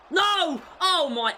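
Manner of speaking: high loud cry of dismay; anguished shout. Real, not acted. Gamer crying out as his side concedes. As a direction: shout in despair.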